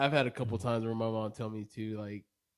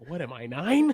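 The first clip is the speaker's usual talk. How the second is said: higher, louder, rising in mock offense; indignant retort; 4 semitones higher, 5 dB louder than usual. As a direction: toss back an insulted question. Mock retort to being scolded.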